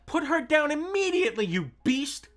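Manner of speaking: high, loud furious shout. Acted. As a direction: yell at the villain to drop her. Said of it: "Klaus shouted".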